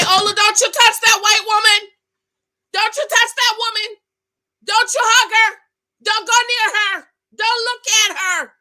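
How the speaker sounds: high, loud pleading yell at the screen; screaming warning. Retold or imitated. Vlogger yelling at Olu on the screen, high and loud. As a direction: shout it like you're begging him to stop. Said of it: "I was screaming".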